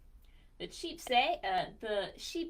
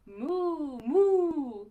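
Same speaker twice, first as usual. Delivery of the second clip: loud, higher mooing cow; 5 semitones higher, 14 dB louder than usual. Acted. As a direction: moo like a big cow for the kids. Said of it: "Cow says".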